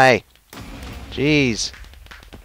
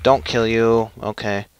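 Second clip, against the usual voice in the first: usual speaking voice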